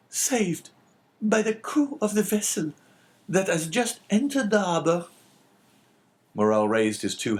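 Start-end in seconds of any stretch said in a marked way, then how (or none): 0.1-5.1 put-on very high, tremulous girl's voice; tearful young girl. A narrator's reading. Daughter in father's arms, high and tearful.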